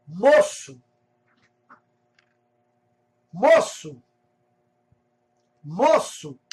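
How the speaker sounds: loud single-word call, pitch swooping up then falling; retold calling out. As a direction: imitate a boy hailing a passer-by, punched out. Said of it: "ele me gritou".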